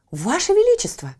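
high, loud exclamation; exclaiming. Acted. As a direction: cry out to the king. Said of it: "вскричала прачка". (громкий возглас)

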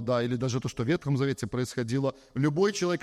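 ordinary talk

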